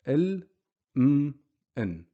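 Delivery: "Il, m, n" as isolated vowelless consonants, spaced out; half the sound. Shown as a demonstration. Teacher recapping sukoon, isolated half sounds. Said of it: "We just make half the sound".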